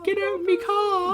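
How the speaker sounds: high, slow meme voice, slightly hammed; high Vine quote voice. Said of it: "That was a Vine".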